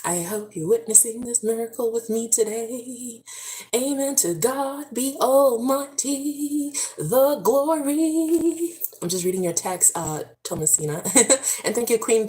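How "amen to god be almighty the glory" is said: high, slow gospel praise; slow raised church praise; preaching praise. Real, not acted. Praising God.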